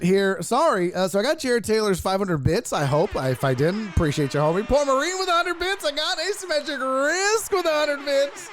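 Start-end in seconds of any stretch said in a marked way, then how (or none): 4.7-8.5 high-pitched donor announcement; hollered shout-out. Pretend.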